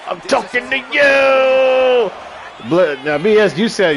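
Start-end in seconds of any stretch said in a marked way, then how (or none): 0.1-2.1 slow higher mock yell; hammed-up cartoon shout.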